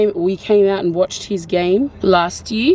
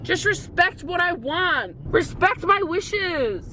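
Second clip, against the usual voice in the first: high, slow, softer insistence; mock pleading protest; 7 semitones higher, 6 dB quieter, slower than usual. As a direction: drag out each demand plaintively. Mock quarrel over her snack.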